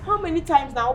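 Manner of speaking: angry accusation. Acted. Accusing her sister in a quarrel.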